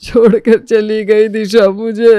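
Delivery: slow, drawn-out sobbing lament; hammed-up heartbreak; anguished wail. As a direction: wail like an abandoned husband. Husband wails that his wife left.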